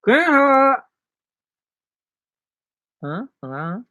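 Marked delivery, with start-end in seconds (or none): 0.1-0.8 calling out. Real, not acted. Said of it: "calling out to Keto Mommy".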